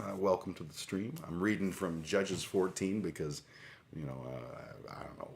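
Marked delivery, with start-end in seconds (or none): 3.9-5.3 whispered aside. Real, not acted. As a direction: drop to a whisper, as if confessing. Confesses she doesn't know why.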